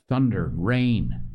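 usual speaking voice

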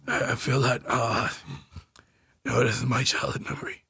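hushed mocking whisper of his line. Teasing the cohost by whispering his intro. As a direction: breathe it out like a secret.

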